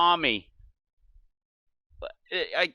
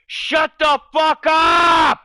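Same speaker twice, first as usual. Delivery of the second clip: slow, furious shout, much higher and louder; yell; 11 semitones higher, 9 dB louder, much slower than usual. Pretend. Pretend yell at New Jersey cold cut talkers. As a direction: scream it at them. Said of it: "I just wanna, like, yell out to them".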